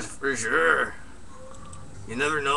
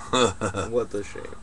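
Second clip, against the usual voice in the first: slow, lowered rueful sigh of "What a shame"; mock lament; 8 semitones lower, 5 dB quieter, much slower than usual.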